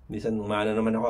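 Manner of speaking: ordinary delivery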